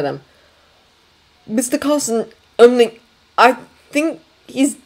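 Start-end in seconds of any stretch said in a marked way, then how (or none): 1.5-4.8 high, halting admission. A narrator's reading. Servant breaks bad news to his superior, halting. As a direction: falter between words, pitched up.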